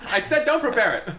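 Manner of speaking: loud mock outrage; impatient yelling. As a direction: shout it like a fed-up diner. Continuing the critic's impatient yelling.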